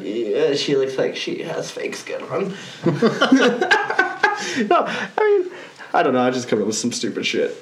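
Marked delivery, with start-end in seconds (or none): 1.1-2.5 whispering. Acted. Continues the whispered aside. 2.6-4.6 high laugh. Real, not acted. Laughs at own joke. 4.7-5.5 laughing. Real, not acted.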